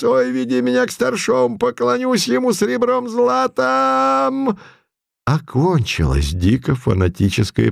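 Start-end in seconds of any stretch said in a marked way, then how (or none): 0.0-4.6 put-on high mumbling old man voice; toothless mumble. Putilin in old man disguise.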